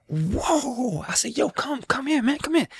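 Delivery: high, excited calling out across the platform. Retold or imitated. Musician calling a singer over across the subway platform, excited. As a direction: call him over, thrilled.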